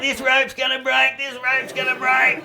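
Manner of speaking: high, slow whine; mock whiny girlfriend. Quoting the girlfriend complaining in the trailer, high slow whine. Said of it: "She said".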